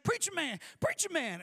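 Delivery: high, loud imitation of an excited seatmate; urgent calling. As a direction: call out to rouse a sleeper. Loud woman shakes him awake, calling.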